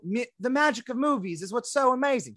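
lightly mock an Australian actress gushing; mock Australian accent. Podcast host mocks Margot Robbie's Australian accent. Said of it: "she is Australian, so this is an accurate imitation".

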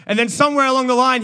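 high laugh rolling into fast words. Real, not acted. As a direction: laugh then press on. Laughs at own joke.

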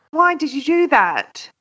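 normal talking voice

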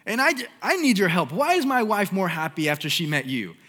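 mock husband pleading high and loud; preacher retelling a husband's phone call, high and loud; frantic husband. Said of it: "he calls and he goes".

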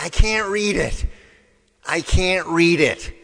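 loud exasperated insistence; mock frustrated shout. Patient protesting loudly to the eye doctor, mock exasperated.

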